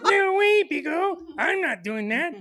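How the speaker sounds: very high mock protest; wife's refusal, imitated. Refusing to spray the wasps, high mimicked protest. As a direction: squeal an indignant refusal, easing slightly. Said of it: "And she's all like".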